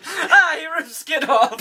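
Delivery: high, drawn-out giggly shock; laughing mock horror. Witness sees the mask come off, laughing mock horror. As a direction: act appalled while cracking up. Cracking up over mask joke.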